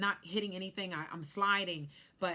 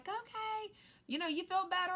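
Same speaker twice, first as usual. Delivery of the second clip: gentle sister; 12 semitones higher than usual. Retold or imitated. Praying sister calming her on the phone, gently. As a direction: soothe her like a calm sister.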